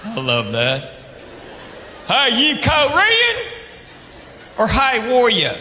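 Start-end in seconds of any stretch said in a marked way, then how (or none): 2.1-5.6 mock Southern yokel. Southern local mangling Hawaiian.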